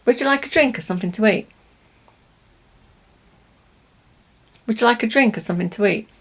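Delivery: quick, run-together, weak forms; natural connected speech. Shown as a demonstration. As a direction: say it fast like a native. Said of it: "when we speak like this in natural English".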